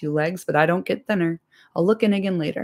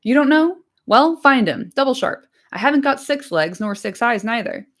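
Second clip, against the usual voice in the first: impatient landlord; 4 semitones higher than usual. Acted. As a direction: hurry the servant along, testy. Landlord orders Nob.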